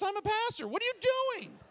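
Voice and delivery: high, scandalized outcry; acted horrified protest. Pastor's unspoken thought at woman undressing, high horrified protest. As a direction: pitch climbs into comic disbelief.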